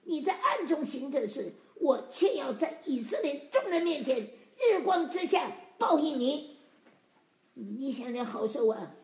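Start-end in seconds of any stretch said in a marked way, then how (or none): 0.0-6.6 forceful, raised preacher's reading; emphatic scripture reading. Acted. Preacher reads God's judgement on David to the congregation, emphatic scripture reading. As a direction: proclaim the verse phrase by phrase.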